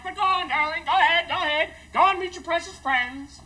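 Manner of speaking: high, loud, wounded dismissal; martyred send-off, stretched at the end; sarcastic mother. Acted. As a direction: wave him off with injured sarcasm.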